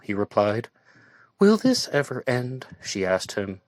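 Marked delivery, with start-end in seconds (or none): 1.4-2.7 higher, louder plea; lightly voiced weary Joanna. A narrator's reading. Joanna asking Barry if it will end, higher plea. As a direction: ask it like she can't take more.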